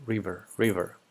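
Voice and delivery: "river" with a short i, RIV-er; short-i pronunciation. Shown as a demonstration. Streamer settling on the English word, short-i pronunciation.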